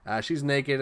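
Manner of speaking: ordinary talk, nothing marked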